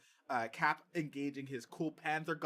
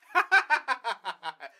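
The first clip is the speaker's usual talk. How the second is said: loud laugh; 8 semitones higher, 11 dB louder than usual. Real, not acted. Laughs at Mangold joke.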